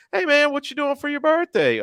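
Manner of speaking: high, put-on innocent voice; sky-high mock voice angling for a party invitation; mock party crasher. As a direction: fish for an invite with fake casualness. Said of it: "Richard, I'd be like".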